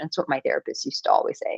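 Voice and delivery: ordinary delivery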